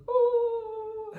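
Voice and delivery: overjoyed squeal at the castle; high squeal. Retold or imitated. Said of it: "I was like".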